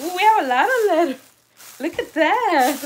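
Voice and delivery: high, loud, delighted burst; excited. Real, not acted. Host shows viewers a pile of letters, excited. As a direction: exclaim with real delight.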